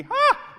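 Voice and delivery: sharp laugh. Real, not acted. Laughing at herself.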